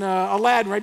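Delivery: normal talking voice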